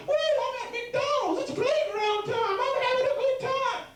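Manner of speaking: put-on very high, slow, giddy kid voice; excited kids. Kids at McDonald's cheering, high and giddy. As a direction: squeal it like hyper kids at a playground.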